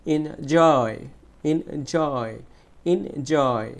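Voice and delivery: Enjoy with "joy" drawn out; stretched stressed syllable. Shown as a demonstration. Teacher leading students to stretch together, long stressed "joy" in enjoy. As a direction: lean long on the second half of enjoy. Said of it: "একসাথে stretch করি".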